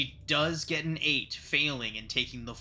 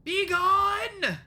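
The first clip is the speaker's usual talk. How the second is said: high, loud frantic shriek; shrieking madman; 8 dB louder, much slower than usual. A narrator's reading. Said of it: "he begins shrieking".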